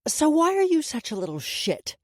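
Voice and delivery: high-pitched, heated demand; angry confrontation. A narrator's reading. Woman turns on the rude boy, angry. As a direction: lash out at the boy, pitch jumping. Woman seizes the boy's hair.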